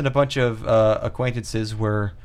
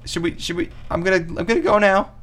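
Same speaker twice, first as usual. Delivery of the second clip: timid caller; 8 semitones higher than usual. Acted. Diner timidly ending a call with Chad, high and hesitant. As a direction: ask permission in a small squeak.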